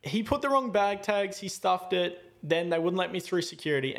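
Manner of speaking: high-pitched, insistent retold complaint; barely holding it together. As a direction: lay out the blame emphatically, temper just contained. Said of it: "trying to just hold it together".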